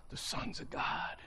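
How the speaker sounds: hushed and reverent, dropping to a whisper; awed whisper closing the verse; whispered scripture. Retold or imitated. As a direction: fade the last words to a breathy whisper.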